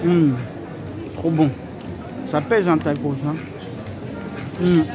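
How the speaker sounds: muffled words between bites; talking while chewing. Real, not acted. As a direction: mouth partly full of food. Seen 4: Bite at -5s; lips closed, cheek bulging, chewing while speaking.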